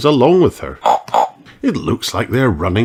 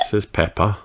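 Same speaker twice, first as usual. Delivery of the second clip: usual speaking voice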